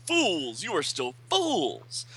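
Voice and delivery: a hint of a sneering villain; Mordru. Villain Mordru scolding the teachers, mild sneer. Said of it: "and Mordru says".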